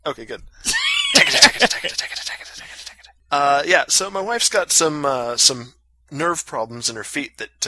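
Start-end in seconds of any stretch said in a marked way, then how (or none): 0.5-2.9 loud cackling laugh, high and shrieking; big laugh. Real, not acted. Host cracking up at the cohosts' teasing, shrieking laugh.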